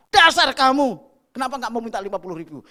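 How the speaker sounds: loud, high scolding burst that speeds up; angry boss. Retold or imitated. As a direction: snap at the sly staffer. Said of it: "Dikasih tapi dengan marah".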